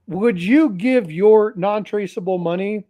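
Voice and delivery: normal talking voice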